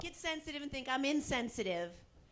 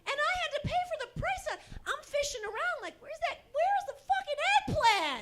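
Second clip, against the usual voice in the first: high, loud, fast indignant rant; shrieking disbelief at the missing eggplant; outraged rant; 13 semitones higher, 10 dB louder than usual. Acted. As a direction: squeal it in disbelief.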